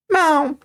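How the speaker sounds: high mock meow; cat meow. Cat meows.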